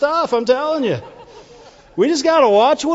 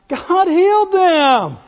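high, loud and slow; slow ringing exclamation; emphatic exclamation; 7 semitones higher, 5 dB louder, slower than usual. Real, not acted. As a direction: lift it, proclaim it.

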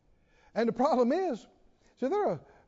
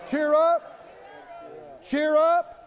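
building cheer; 6 semitones higher, slower than usual. Shown as a demonstration. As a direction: lead the crowd in a rising cheer up chant.

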